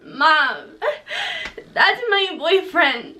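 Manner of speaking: put-on high, pleading childish whine; mock upset kid; whiny kid. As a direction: imitate her younger self protesting. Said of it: "I was like".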